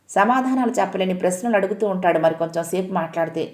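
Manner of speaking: ordinary delivery, nothing marked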